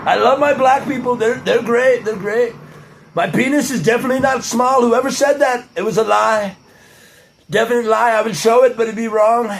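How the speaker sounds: high, boastful presidential parody; Donald Trump impression. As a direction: praise a man like a rally showman. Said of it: "Donald Trump impression".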